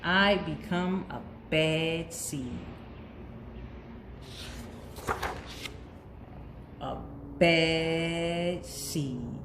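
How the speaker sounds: slow, drawn-out dark declaration; brooding villain reveal; ominous slow. Acted. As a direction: let each word drop slowly and heavily. Bad seed dramatic self-declaration.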